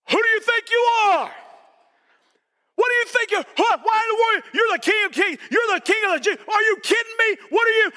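high, shouted taunts of disbelief; jeering crowd. Acted. Crowd taunting the man carrying the cross, jeering shout. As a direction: heckle him from the roadside, pitched high.

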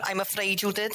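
high, overly polite apology; apologetic Jeff. Acted. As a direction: grovel politely.

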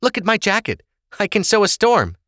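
high, insistent protest. A narrator's reading. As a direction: prove your mother wrong, pitched high. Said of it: "Ava protested".

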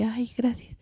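very soft, whispery acted thanks; naive thanks. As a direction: thank a friend in a tiny voice. Agradece ingenua en anécdota. (agradecimiento susurrado)

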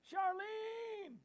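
high drawn-out call; calling out Charlene. Retold or imitated. Host voicing Phyllis calling to Charlene, high playful call. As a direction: sing out her name across the room. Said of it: "Phyllis is yelling at you".

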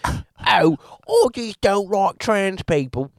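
high, hammed-up British accent; a sneering mock British accent. Mocking J K Rowling in a high put-on British accent. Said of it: "He's like, / with an accent".

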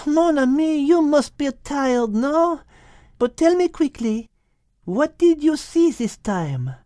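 put-on high voice with a French accent; little French cat, pleased with her friend; French-accented Liz. Liz asks the dove.

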